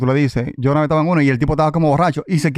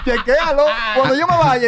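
laughing; 10 semitones higher than usual. Real, not acted. Laughing retelling the bottle threat.

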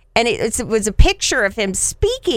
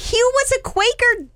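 much higher, stunned disbelief; amazed squeal of discovery; astonished. Retold or imitated. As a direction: blurt it out in shock. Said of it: "I said".

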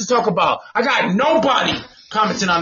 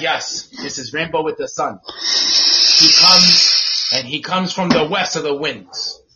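mock Cherokee elder; 6 semitones lower than usual. Host mocking long baby names, low mock-elder voice. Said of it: "from the Cherokee clan over there".

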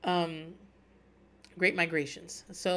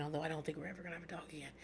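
quick, much softer muttered aside; 2 semitones lower, 15 dB quieter, much faster than usual. Real, not acted. Telling listeners about getting a dog, a quick soft muttered aside. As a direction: slip the truth in under your breath, fast.

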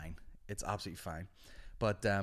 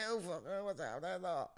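high, softer mock slur; glue-sniffing spide; 9 semitones higher, 5 dB quieter, slower than usual. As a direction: babble like a lad off his head. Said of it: "you smell the glue off him".

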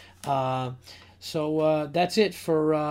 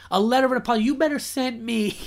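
mock outrage; 8 semitones higher than usual. Real, not acted. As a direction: scoff at "A letter of an apology" as if it's absurd.

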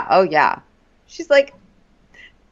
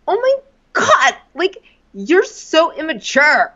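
high, drawn-out whiny imitation; mock exasperation; 10 semitones higher, slower than usual. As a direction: huff it like a petulant man-child. Mocking his exasperated whine.

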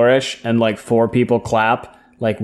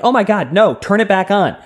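imitated urgent protest; 6 semitones higher than usual. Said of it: "You were like".

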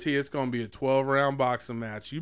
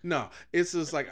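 ordinary delivery

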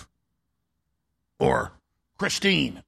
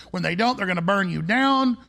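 normal talking voice

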